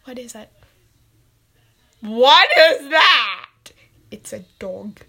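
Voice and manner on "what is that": loud demand. Acted. Parent character sees a dog.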